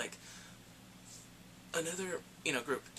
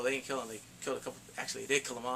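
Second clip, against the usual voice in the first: normal talking voice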